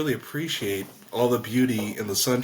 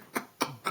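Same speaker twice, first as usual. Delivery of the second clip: laugh; 3 semitones higher, 8 dB quieter than usual. Real, not acted. Laughing at his own scenario.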